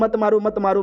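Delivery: high, quick plea for mercy; retold pleading. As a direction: beg for your life, lightly acted. Victims plead for their lives.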